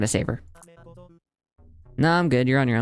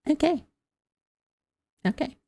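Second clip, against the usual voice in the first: stunned squeak; 13 semitones higher than usual. Real, not acted. Stunned reaction to death.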